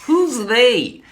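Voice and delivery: high, loud mock cartoon voice; sketch voice. Quoting the Robot Chicken sketch back, high loud cartoon voice. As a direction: squeal the question like a cartoon.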